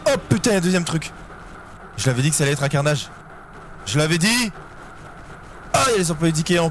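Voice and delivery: fast, high and shaken; rattled and rushing; panicked. Real, not acted. Second monster appears.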